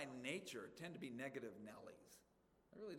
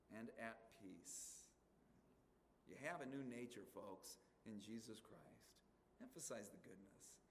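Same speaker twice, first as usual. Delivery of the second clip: gentle soft close; 4 semitones lower, 7 dB quieter than usual. Real, not acted. Preacher softening to gentle close.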